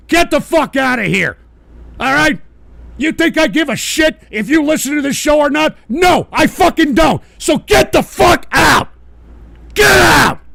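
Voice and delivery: high, very loud, building rage; furious screaming. Real, not acted. Streamer telling listeners to leave, screaming in rage. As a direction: build to an explosive bellow.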